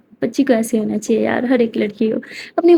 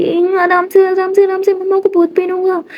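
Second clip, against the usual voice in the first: anxious pleading; 8 semitones higher than usual. Acted. Kiran frets over mom's injection.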